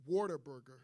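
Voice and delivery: "Whataburger" said like "Waterburger"; mistaken pronunciation. Shown as a demonstration. Admitting an old mistake about a Texas chain, "Whataburger" said as "Waterburger". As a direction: make the start of "Whataburger" sound like "water". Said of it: "I used to think it was called".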